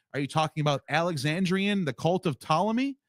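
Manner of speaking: normal talking voice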